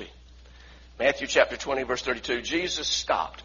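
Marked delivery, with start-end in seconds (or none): 2.4-3.4 slow, deliberate, a bit softer; slow, weighty. Shown as a demonstration. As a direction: let each word land slowly. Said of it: "I want you to repeat that".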